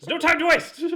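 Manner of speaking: high, loud, urgent exclamation; hammed-up dramatic urgency; mock urgent hero. Mock urgent movie line.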